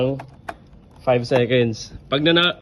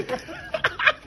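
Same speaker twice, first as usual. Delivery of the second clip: high laugh; 5 dB quieter than usual. Real, not acted. Friend fails again.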